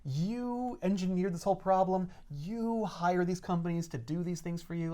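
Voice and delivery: soft, slow, deliberate blame; quiet accusation. Acted. Captain blaming the boss, quietly and deliberately. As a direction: lean on each YOU, softly and slowly. Cap acted blaming Fury.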